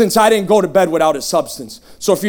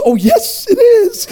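high, chirpy mock-happy voice; happy program member; 12 semitones higher, slower than usual. Program member confirming it's in the Bible, overjoyed. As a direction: pitch it way up, gleefully certain. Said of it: "One of those Teen Challenge happy people".

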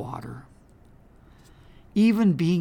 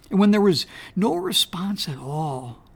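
ordinary delivery

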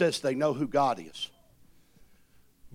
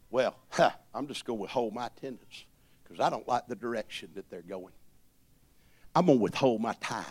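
mocking sulky churchgoer; 8 semitones lower, faster than usual. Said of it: "manipulative actions".

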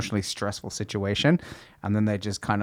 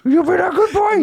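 much higher, laughing dog praise; 5 dB louder than usual. Acted. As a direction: squeal it at a puppy. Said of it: "I even talk to myself in a dog voice".

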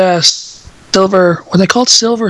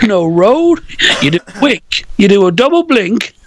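higher, playful bad British accent; mock British accent; 4 semitones higher than usual. Joking along about Mary Poppins, mock British accent. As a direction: lightly put on a silly British film accent.

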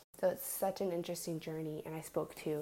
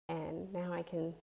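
ordinary talk

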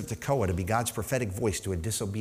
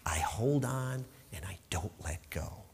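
whispering; 5 semitones lower, 9 dB quieter than usual. Acted. Quiet climax of read verse.

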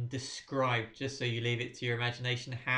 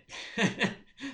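high laugh; 11 semitones higher than usual. Real, not acted. Laughing at electromagnet joke.

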